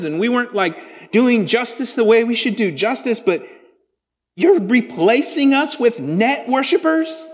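high, loud, indignant protest; Habakkuk pleading with God in outrage; exasperated prophet. Retold or imitated. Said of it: "He's like".

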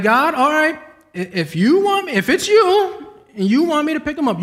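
half-joking bargain with God, voice climbing; pleading with God. Retold or imitated.

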